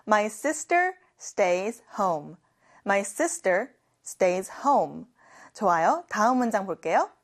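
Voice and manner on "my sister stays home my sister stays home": slow, careful teacher read; slow model sentence. Shown as a demonstration. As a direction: read it slowly for learners to copy. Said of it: "같이 해볼까요". (천천히 따라 하기 시범)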